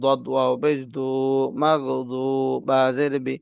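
normal talking voice

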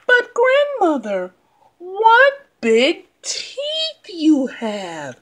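put-on high, slow, wondering girl; curious little girl. Girl questions the wolf.

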